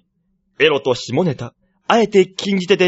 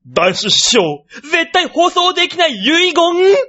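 headline announcement; 10 semitones higher, much slower than usual. Acted. Announcing the first news headline.

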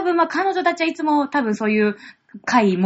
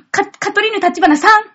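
higher, louder ojousama address; haughty rich girl japanese; 7 semitones higher, 6 dB louder than usual. Acted. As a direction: address her like a lady. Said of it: "呼び捨てちゃった". (お嬢様口調で呼びかける)

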